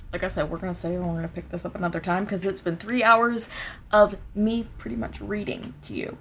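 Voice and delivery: ordinary delivery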